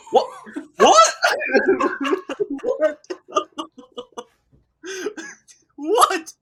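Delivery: squealing high, loud What with laughter; shocked disbelief. Retold or imitated. Reacting to nude massage offer.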